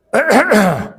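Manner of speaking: long, rumbling throat clear. Acted. Shopkeeper about to explain to the boy, theatrical throat clearing. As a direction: clear the throat before a speech.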